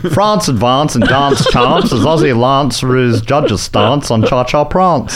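South Australian accent, long "ah" in France, dance and prance; put-on South Australian accent, slow and deliberate; Marcel's South Australian accent. Shown as a demonstration. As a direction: read it the South Australian way, drawn out with broad ah vowels. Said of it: "do it in Marcel's accent".

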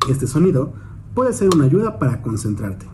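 ordinary talk

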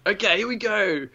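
high excited showman voice; eager inventor voice. Retold or imitated. Imitating the inventor starting his demo, high and eager. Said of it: "so he's like".

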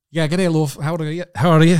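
hammed-up fake Irish trying to pass as Scottish; mock Scottish accent chatting up a girl. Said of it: "Oh, that's awful".

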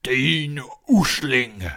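put-on slow, loud ogre-like old man; shouting old giant. A narrator's reading. Huge old man threatening the boy, booming shout. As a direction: boom it out, drawing each word long. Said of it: "råbte han".

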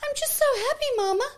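put-on high, loud, girlish voice; imitating her daughter. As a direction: chirp it like a busy little girl. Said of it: "she goes".

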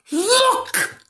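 very high squeaky alarm; alarmed again. Shown as a demonstration. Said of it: "Do you think that was alarmed?".

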